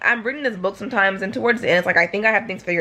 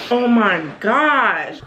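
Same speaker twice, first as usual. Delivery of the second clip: overcome; 4 dB louder, much slower than usual. Real, not acted. Overcome by sad scene.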